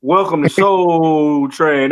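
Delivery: smooth, slow show-host voice; Don Cornelius. Retold or imitated. Co-host imitating his pal's smooth intro voice, slow and drawn out. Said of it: "you got that Don Cornelius voice".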